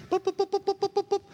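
high mock electronic boops; arcade game beeps. Preacher recalling Space Invaders, imitating high game boops. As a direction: beep like an old arcade machine.

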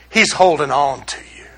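low, soft comfort; gentle reassurance. Acted. Gospel reassuring believers they are held, low and soft.

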